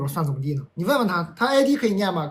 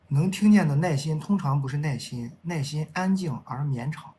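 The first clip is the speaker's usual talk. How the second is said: measured love-poem recital; poem reading; 2 semitones lower, slower than usual. Acted. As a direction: recite it slowly, letting each line fall away. Reads a love poem aloud.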